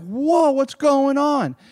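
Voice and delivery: mock panic, pitched well up; freaking out. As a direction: cry it out like an alarmed disciple. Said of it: "freaking out".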